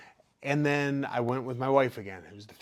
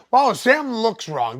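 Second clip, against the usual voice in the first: higher, slower commentator voice; 8 semitones higher, 7 dB louder, slower than usual. Retold or imitated. TV announcer reacts to the limping fighter, higher and slower. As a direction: play the puzzled TV announcer. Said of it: "the announcer says".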